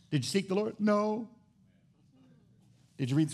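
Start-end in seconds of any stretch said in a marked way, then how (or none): none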